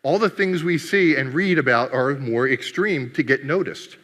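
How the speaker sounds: usual speaking voice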